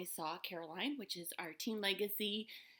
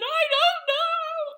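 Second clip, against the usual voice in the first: high, loud, slow sobbing wail; retold sobbing; 13 dB louder, much slower than usual. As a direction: wail it out through tears, stretched and broken. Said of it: "She did not expect the sobbing".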